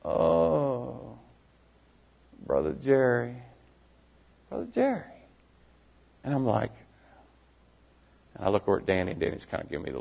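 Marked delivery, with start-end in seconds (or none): 0.0-5.0 soft, halting imitation of a dazed man; impaired man's speech. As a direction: speak softly, vacantly, with long gaps. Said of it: "he talks like this".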